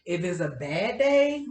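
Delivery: usual speaking voice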